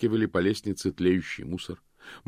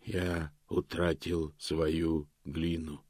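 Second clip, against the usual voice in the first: slow stone monotone; 3 semitones lower, 6 dB quieter, much slower than usual. A narrator's reading. Golem's ghost speaks to Death.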